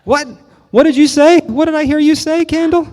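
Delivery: high, loud startled demand; jolted awake, shouting indignantly; high, loud cry of a rudely woken pitcher. Acted. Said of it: "suddenly awakened by the noise".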